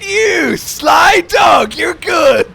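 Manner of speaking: delighted shout. Real, not acted. Delighted at friend's joke.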